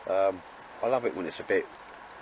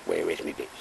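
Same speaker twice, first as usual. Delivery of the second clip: deep, whispered dog voice, much lower; dog's inner voice; 4 dB quieter than usual. Pretend. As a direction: voice the dog in a low, breathy whisper. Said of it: "He's saying".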